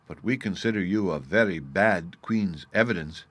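ordinary delivery, nothing marked

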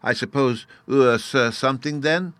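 put-on high curious child's voice; a little boy asking, rising at the end; childish fairy. A narrator's reading. Fairy child quizzing the narrator, high and curious. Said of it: "said the little creature".

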